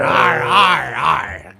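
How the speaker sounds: loud, high pirate shout; shouted pirate arr. Pretend. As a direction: bellow it like a pirate. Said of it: "we love to talk like pirates".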